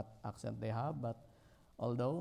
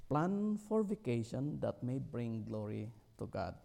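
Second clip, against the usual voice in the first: normal talking voice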